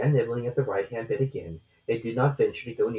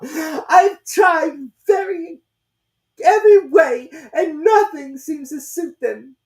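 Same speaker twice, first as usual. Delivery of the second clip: very high, slow, tearful bird voice; sobbing; 13 dB louder, slower than usual. A narrator's reading. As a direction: sob it out in despair. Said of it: "with a kind of sob".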